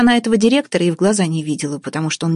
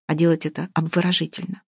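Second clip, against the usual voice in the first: plain reading voice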